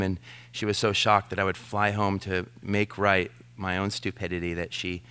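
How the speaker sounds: usual speaking voice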